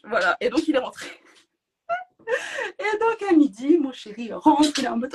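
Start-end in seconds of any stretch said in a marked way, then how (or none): 2.3-2.7 a squeal of laughter, much higher. Real, not acted. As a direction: let a high giggle escape. Laughs while recalling the code word.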